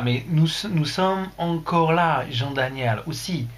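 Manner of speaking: slow French with a put-on English accent. Streamer replying to Jean-Daniel, mock English accent. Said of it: "ça claque bien avec l'accent anglais".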